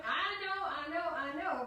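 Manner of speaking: loud, high, cutting in; impatient interruption. Acted. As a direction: brush off the objection loudly. Jack interrupts doubting boys.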